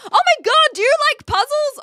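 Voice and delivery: high, loud squeal of excitement; excited outburst. Real, not acted. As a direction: burst out shrieking with excitement at a viewer. Sudden outburst at a viewer's message.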